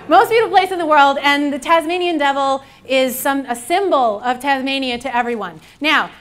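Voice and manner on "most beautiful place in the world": louder and quicker, punching it home; emphatic. Real, not acted. Thanking the crowd, then repeating it louder and quicker. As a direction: repeat it proudly over the applause.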